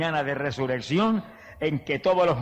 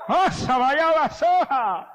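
much higher, slow exclamation in tongues; ecstatic tongues; 11 semitones higher, much slower than usual. Real, not acted. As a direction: burst out praying in tongues. Preacher bursts into tongues. (exclamación en lenguas, aguda y lenta)

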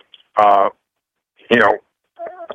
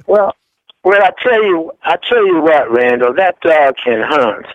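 mock Southern accent; 4 semitones higher than usual. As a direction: play a good ol' Southern boy with a Southern accent, half joking. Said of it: "how was my southern right there?".

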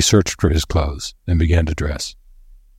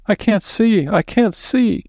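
very high whimpering housekeeper; wailing moan; slower than usual. A narrator's reading. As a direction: wail helplessly in a high voice. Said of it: "she moaned".

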